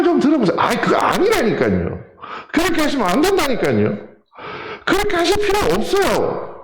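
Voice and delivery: put-on an older disciple losing his temper at Jesus; heated protest. Peter protesting to Jesus, voice rising in heated argument. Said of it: "베드로가 언성이 높아지지 않았을까".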